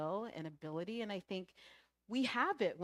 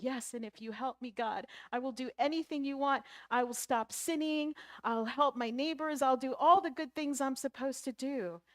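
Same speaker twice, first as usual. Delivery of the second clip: raised, louder string of promises; pleading, building higher and louder; desperate bargaining; 5 semitones higher, 6 dB louder than usual. Acted. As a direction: pile up eager vows to be saved. Jack bargains desperately with God.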